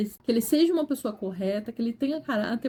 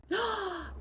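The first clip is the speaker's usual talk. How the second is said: shocked gasp; 9 semitones higher, 9 dB quieter than usual. Retold or imitated. Hearing she always shouts.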